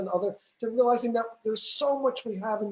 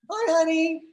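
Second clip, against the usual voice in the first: pet greeting; 9 semitones higher, 4 dB louder than usual. Real, not acted. Greeting the dog on camera.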